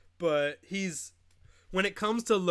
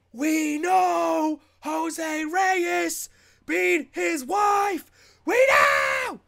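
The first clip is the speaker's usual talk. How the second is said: higher and much louder, building to a yell; mock outraged shout; 10 semitones higher, 7 dB louder, much slower than usual. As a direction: rant it at full volume, getting higher.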